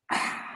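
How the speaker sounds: long breathy "ah" after a drink; mimicked drink-finishing exhale; imitated after-shot exhale. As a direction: let out a satisfied post-shot sigh. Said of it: "took my shot and I went".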